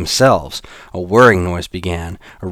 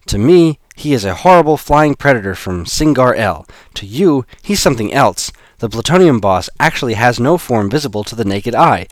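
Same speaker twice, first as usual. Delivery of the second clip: raised, urgent explaining voice; urgent young woman; 5 semitones higher, 5 dB louder than usual. Acted. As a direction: press the point urgently. Eve urgently explains the boss.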